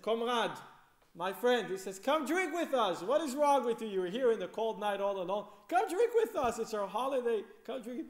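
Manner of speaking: put-on loud, high, jovial drunk calling out; drunken invitation. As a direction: hug him and boom it out, tipsy and merry. Drunk Reb Shmuel hugs officer.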